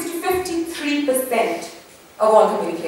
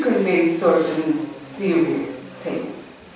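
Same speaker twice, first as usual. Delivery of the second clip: lower, slow, deep serious tone; deeper voice; 8 semitones lower, 5 dB quieter, much slower than usual. Shown as a demonstration. Showing the class a serious tone: low and slow. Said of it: "a lower pitch or a deeper voice".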